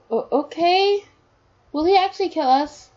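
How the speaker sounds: put-on high little-kid question; child's voice. Son asking parent while hiding, in a high child's voice. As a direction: pitch it right up like a small son.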